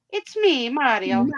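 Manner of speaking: high, playful Italian game-character voice; cartoon Italian accent; Mario. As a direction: announce yourself with bouncy Italian flair. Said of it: "We sound like Mario Brothers".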